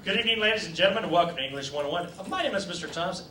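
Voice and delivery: a hint of a formal teacher, brisk start; lightly voiced English teacher. A hint of teacher greeting his class. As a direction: play the stiff teacher opening class. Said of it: "Had I been an English teacher".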